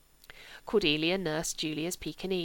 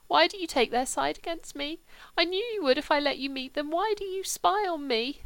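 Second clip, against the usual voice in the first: weeping; 9 semitones higher, 3 dB louder than usual. Acted. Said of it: "Sebastian began to weep".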